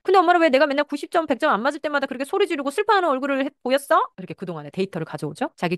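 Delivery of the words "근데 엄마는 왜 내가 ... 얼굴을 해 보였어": high and loud accusing question; accusing child. Acted. Child confronting the mother about her yelling, high and loud. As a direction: throw the accusation back at mom, pitched up.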